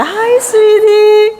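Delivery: high, loud, drawn-out sweetheart greeting; gushing demonstration of a loving hello; expressive endearment. Shown as a demonstration. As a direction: stretch it out slowly and sing it high. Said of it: "얼굴 표정도 중요합니다".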